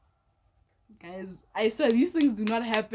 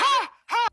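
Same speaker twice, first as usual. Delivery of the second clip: loud, high-pitched mocking "ha"; mock laugh; 9 dB louder than usual. The two girls laughing at her, imitated as a loud mock laugh. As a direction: imitate their laughter in sharp, high bursts. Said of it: "look at each other and laugh".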